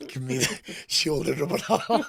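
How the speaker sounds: laughing through the words, pitch up; cracking up mid-line while bragging. Real, not acted. Telling an inmate about his backers, laughing.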